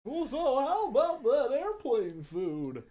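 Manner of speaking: high cheesy comic voice, going for the laugh; stand-up comedian. Pretend. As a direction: deliver it like a hack stand-up bit. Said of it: "bring out my comedy set for you".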